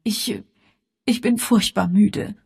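high, halting, tearful voice; falter, then complain in a high whimper; tearful whine. A narrator's reading. Exhausted daughter pleading to be excused, tearful. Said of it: "mit weinerlicher Stimme".

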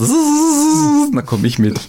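hohes, lang gezogenes Summen einer anfliegenden Mücke; Schnakensummen. Pretend. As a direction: summ wie eine angeschwirrte Schnake. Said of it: "Ich komm da angeschwirrt". (sirrendes Mückengeräusch)

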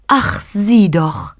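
high, slow, breathy bliss; blissful. A narrator's reading. Mary wakes her husband to see the child, blissful. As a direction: marvel blissfully, drawing out each word. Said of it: "sagte Maria selig".

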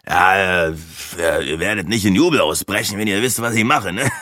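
hedging character voice with a little laugh; stall, then chuckle it off; lightly voiced hesitant Breitling. Breitling admits his job to the group, hesitant and chuckling.

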